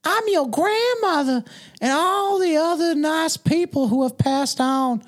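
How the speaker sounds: put-on high, southern old lady voice; a hammed-up southern grandmother; mock southern granny from beyond. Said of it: "I always go southern".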